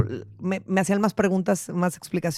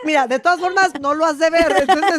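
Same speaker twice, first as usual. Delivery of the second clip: higher, teasing mock mother; lightly voiced teasing mom; 6 semitones higher than usual. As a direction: scold the daughter with a playful lift. Mock reply to daughter.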